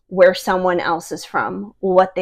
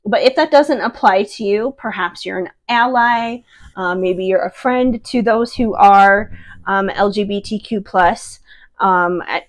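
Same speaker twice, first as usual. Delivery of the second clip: ordinary delivery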